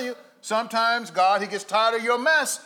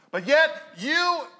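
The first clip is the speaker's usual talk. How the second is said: ordinary talk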